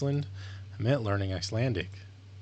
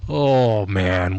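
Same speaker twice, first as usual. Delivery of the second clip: ordinary talk, nothing marked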